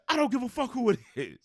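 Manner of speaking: high, loud mock yell; retold angry yell. Friend yelling at his girlfriend, high loud yell. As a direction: spit it out in total fury. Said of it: "He said".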